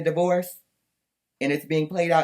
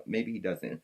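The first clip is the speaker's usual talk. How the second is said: ordinary talk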